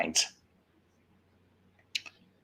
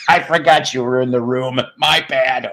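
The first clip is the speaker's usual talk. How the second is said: higher voice through real laughter; embarrassed laughing; 4 semitones higher, 4 dB louder than usual. Real, not acted. Embarrassed, caught by someone in room.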